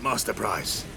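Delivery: plain reading voice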